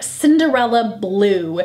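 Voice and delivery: usual speaking voice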